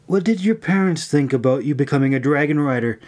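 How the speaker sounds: ordinary delivery, nothing marked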